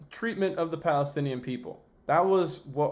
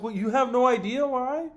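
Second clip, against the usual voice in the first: incredulous retort; 6 semitones higher than usual. Retold or imitated. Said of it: "It's like".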